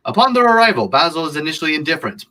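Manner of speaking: high-pitched, dramatic storyteller; theatrical narration. Acted. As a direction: launch into it high, like a grand narrator. Theatrical synopsis reading.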